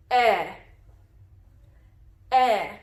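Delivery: a clear, held short "Eh" as in "egg"; short e sound. Shown as a demonstration. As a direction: model the short vowel "Eh" for kids to copy. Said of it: "The short vowel sound for E is eh".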